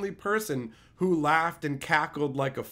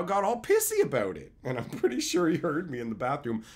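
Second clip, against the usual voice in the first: ordinary delivery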